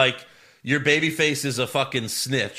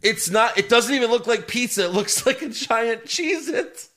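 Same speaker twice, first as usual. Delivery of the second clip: laughing; 9 semitones higher than usual. Real, not acted. Laughing at pizza ad.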